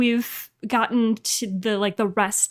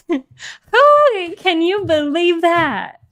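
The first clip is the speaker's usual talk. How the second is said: put-on playful Southern accent, high and loud; 6 semitones higher, 4 dB louder, slower than usual. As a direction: put on a Southern drawl for fun. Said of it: "I feel like I need to pull out my Southern accent".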